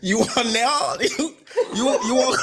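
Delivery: high, laughing mock foreign accent; mock broken-English accent. Mocking how customers talk at the nail shop, bad foreign accent. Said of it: "horrible version of their accent".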